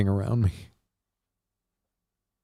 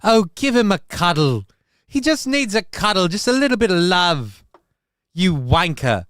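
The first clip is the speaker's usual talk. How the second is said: hammed-up British accent, pitched higher; 4 semitones higher than usual. Voicing Sam Chow's chat message to Johnny, hammed-up British accent. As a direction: play the chatter as a cheeky Brit. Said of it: "I don't know why I made him British".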